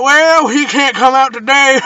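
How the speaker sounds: loud, slow, high mocking rep voice; cable company worker. Cable company brushes off a caller, mockingly drawn out.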